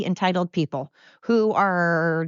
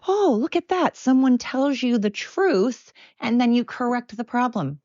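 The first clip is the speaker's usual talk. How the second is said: gasp, then mock amazement, much higher; bitter sarcasm; 5 semitones higher than usual. Podcast host mocking listeners who dodge the truth, sarcastic gasp. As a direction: fake wide-eyed wonder at something obvious. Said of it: "Man, I'm bitter".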